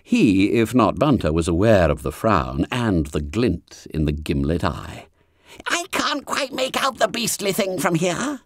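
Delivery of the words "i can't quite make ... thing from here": high, hushed schoolboy whisper; squeaky fat boy whispering in class; Bunter's whisper. A narrator's reading. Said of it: "whispered Bunter".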